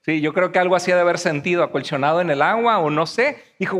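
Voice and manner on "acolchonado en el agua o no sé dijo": normal talking voice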